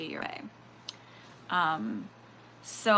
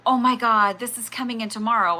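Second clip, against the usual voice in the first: louder, hammed-up "oh my God" reaction; mock excitement; 2 semitones higher, 8 dB louder than usual. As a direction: imitate someone getting carried away. Mimics over-excited reaction she avoids.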